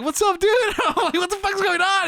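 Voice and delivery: very high, laughing bewilderment; astonished greeting. Retold or imitated. Storyteller greets armed friend in truck, astonished.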